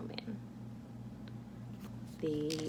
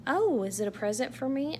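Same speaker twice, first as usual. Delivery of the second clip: higher, a touch of surprised delight; lightly voiced happy surprise; delighted question; 6 semitones higher, 4 dB louder than usual. Santa given a box by elves, mild surprise. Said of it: "asked Santa".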